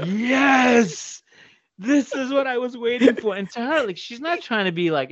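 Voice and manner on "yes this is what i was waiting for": gleeful laugh-through. Retold or imitated. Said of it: "So I'm like, so I went".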